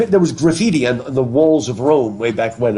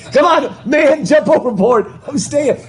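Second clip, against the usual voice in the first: much higher, hammed-up cartoon shout; mock frantic urging; 12 semitones higher, 3 dB louder, slower than usual. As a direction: urge him overboard in a high comic voice. Mimics cartoon raft crew urging jump.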